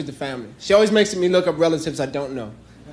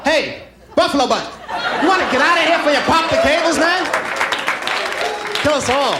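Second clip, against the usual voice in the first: rude elevator shout; 9 semitones higher than usual. Retold or imitated. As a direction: bark at the heavy passenger. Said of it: "It'd be like".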